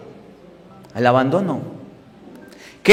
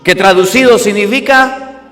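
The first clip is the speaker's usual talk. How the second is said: usual speaking voice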